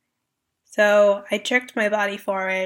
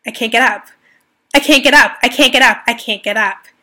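pleading, rising to loud desperation then easing; injured athlete pleading she can't get up; repeated plea; 10 dB louder, faster than usual. Retold or imitated. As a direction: beg louder each time, then fade. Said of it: "I said it again".

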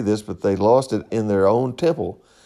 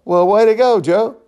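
high, loud mock praise; sarcastic cheer; 9 semitones higher, 5 dB louder than usual. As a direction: cheer them on with a sneer. Mocking the complaining parents.

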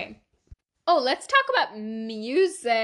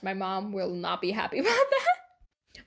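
ordinary delivery

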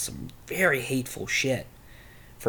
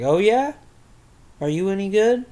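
teasing officer; 8 semitones higher, 8 dB louder than usual. Retold or imitated. Officer teasing, retold quote.

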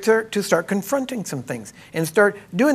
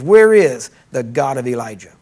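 loud cry; 4 semitones lower, 6 dB louder than usual. Retold or imitated. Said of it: "what we should be yelling today is not".

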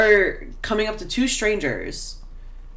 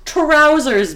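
louder and higher, punched out; emphatic correction; 8 semitones higher, 7 dB louder than usual. Shown as a demonstration. Correcting a friend's blue jeans to trousers, loud and high. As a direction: hammer the word Trousers home.

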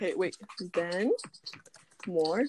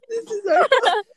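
laughing through the words, pitched up; breaking into laughter; 12 semitones higher, 8 dB louder than usual. Real, not acted. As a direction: lose it halfway through the line. Cracking up mid-line.

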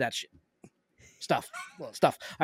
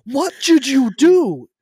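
loud, slow, higher exasperated question; 7 semitones higher, 9 dB louder, much slower than usual. Retold or imitated. Son driving home demanding an explanation, loud and slow. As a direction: drag out the disbelief loudly. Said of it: "and I was like".

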